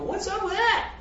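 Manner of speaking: mock bewildered "huh?"; baffled reader. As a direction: reader baffled by the snake bite. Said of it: "you go".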